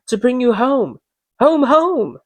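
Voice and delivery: a little sister bubbling with joy; little girl. A narrator's reading. Little Fan fetching her brother home, eager child's voice. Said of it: "bending down to laugh".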